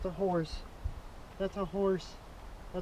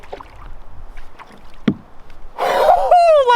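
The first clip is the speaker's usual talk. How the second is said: squealing breathless laugh; a high-pitched burst of glee; delighted laughing squeal; giddy high laugh; 4 dB louder than usual. Real, not acted. Giddy after the catch.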